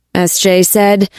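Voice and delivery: ordinary delivery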